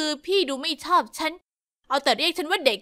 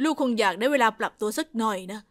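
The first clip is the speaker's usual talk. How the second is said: father comforts the mother about their daughter, low and calm; comforting father; 6 semitones lower than usual. Acted. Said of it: "พ่อพยายามปลอบใจแม่".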